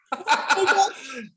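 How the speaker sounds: bright burst of laughter; real laugh. Real, not acted. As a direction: laugh out loud at the surprise. Laughing at the confession.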